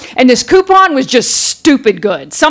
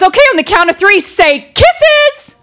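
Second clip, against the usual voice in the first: high chirpy photographer, leaping up on the last word; mock-bubbly portrait photographer; perky photographer; 8 semitones higher than usual. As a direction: sing out the cue for the shot. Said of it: "she gets behind the camera and she goes".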